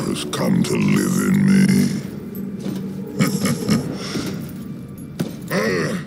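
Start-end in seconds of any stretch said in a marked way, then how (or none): 1.7-5.5 low, breathless gloating chuckle; evil chuckle. Acted. Emperor admiring his new form, low evil chuckle. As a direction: chuckle darkly to yourself, savouring it.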